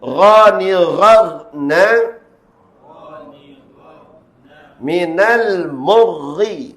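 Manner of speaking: slow drawn-out drill, Minal murri and Ghaniran with long thick ghain; model recitation. Shown as a demonstration. Teacher recites for learners to repeat, slow drill chant. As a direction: chant Mariran and Ghaniran slowly and loudly, pausing after each. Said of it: "Kita tengok iaitu bacaan dia".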